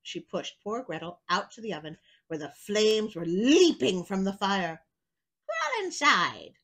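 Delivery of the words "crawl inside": slow, coaxing witch; sly witch. Acted. Witch luring Gretel into the oven, slow and coaxing. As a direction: lure her into the oven slowly. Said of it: "said the witch".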